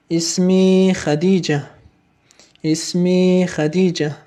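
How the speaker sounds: اسمی خدیجه said slowly, stress on اسمی; slow Arabic model. Shown as a demonstration. Teacher models the answer for students, slow and careful. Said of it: "و اعد، تکرار کن".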